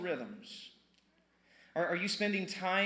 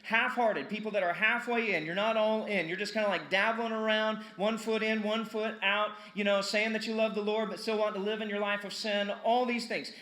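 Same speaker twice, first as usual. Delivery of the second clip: loud, higher, driving sermon voice; full-force preaching; 5 semitones higher, 5 dB louder than usual. Acted. Pastor pressing half-hearted Christians, loud and fervent. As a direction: hammer it home to the congregation.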